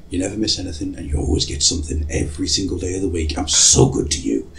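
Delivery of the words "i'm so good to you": smug aside. Pretend. Smug self-praise aside.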